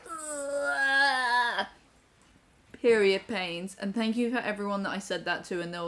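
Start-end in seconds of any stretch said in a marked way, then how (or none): 0.1-1.7 acted-out groan. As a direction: groan it out long, re-enacting the cramps. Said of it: "and I was like".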